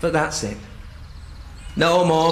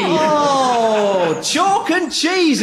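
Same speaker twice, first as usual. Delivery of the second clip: delighted greeting; 7 semitones higher, much slower than usual. Real, not acted. Delighted recognition.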